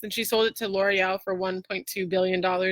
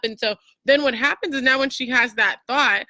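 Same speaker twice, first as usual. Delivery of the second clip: ordinary talk, nothing marked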